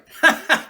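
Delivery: short high laugh at his own pun; laugh at own joke. Real, not acted.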